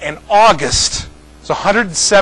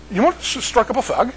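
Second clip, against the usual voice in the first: garbled mock Manchester accent, higher pitch; 7 semitones higher than usual. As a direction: slur it in imitated Northern English. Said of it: "they talk really weird there".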